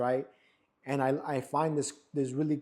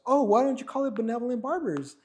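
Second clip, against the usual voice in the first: wife suggesting; 9 semitones higher, 5 dB louder than usual. Retold or imitated. Wife suggests the shop name.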